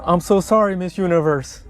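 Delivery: mocking voiceover of a sorry official; fake-dub him as a whiny apologizer; sarcastic mock apology in his voice; mock translation. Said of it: "I'll translate for you".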